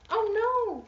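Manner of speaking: high, loud princess cry of alarm. Acted. Princess calls for help, high loud cry. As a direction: squeal it like a frightened princess. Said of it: "cried the princess".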